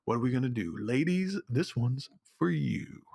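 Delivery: low, slow smooth radio DJ; eighties disc jockey. Retold or imitated. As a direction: croon it like a late-night jazz DJ. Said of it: "eighties disc jockey".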